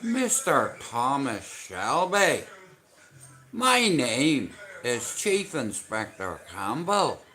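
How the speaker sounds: put-on Belfast detective accent. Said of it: "I can do an accent".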